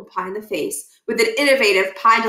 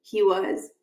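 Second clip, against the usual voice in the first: plain reading voice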